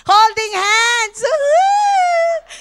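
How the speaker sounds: slow high "Holding hands", then a loud high whoop; excited whoop. Retold or imitated. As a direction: cheer like a giddy wife on a joyride. Retelling joyful wheelchair ride.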